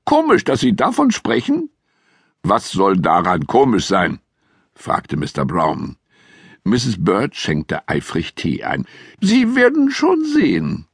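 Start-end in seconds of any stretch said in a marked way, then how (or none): 0.0-1.7 much higher, knowing housekeeper voice; Misses Bird voice. A narrator's reading. Housekeeper hinting at a secret to Mister Brown, high knowing voice. 9.2-10.9 much higher, knowing housekeeper voice; Misses Bird voice. A narrator's reading. Housekeeper hinting at a secret to Mister Brown, high knowing voice.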